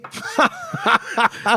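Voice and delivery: high burst of real laughter; a hearty amused laugh; big laugh. Real, not acted. Laughing about brother's busy work.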